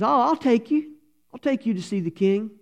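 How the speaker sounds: put-on young man's voice, pitched well up; eager young man. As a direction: sound like a cheerful youth promising. Young man offers to help.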